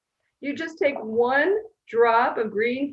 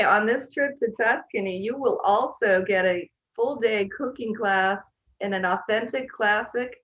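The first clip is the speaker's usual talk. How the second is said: usual speaking voice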